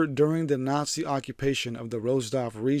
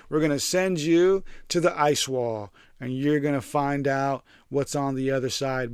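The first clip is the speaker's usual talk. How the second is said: usual speaking voice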